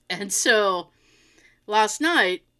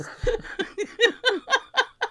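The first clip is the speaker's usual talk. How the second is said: high laugh; 11 semitones higher than usual. Real, not acted. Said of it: "own laugh at the story".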